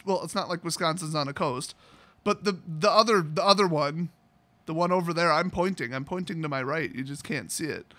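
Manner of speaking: ordinary delivery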